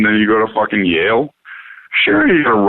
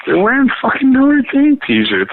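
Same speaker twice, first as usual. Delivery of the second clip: emphatic repeat; 13 semitones higher than usual. Real, not acted. Repeating the Notre Dame shirts to a friend, incredulous. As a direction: hammer it home in disbelief.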